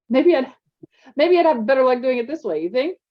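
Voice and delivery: a hint of a cheeky boy; joking Zane. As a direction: play the clown.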